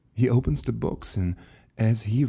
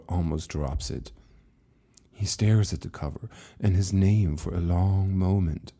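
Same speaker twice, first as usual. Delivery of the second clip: ordinary delivery, nothing marked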